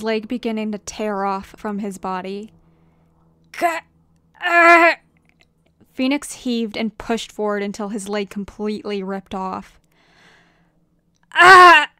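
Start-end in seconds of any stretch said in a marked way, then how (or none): 3.6-5.0 loud, high yell of agony; pained cry. Acted. Tearing his own leg off. 11.3-11.9 very loud, high scream of pain; agonized scream. Acted. Leg completely rips off.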